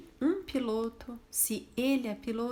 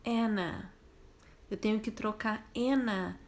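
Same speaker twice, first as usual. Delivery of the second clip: ordinary delivery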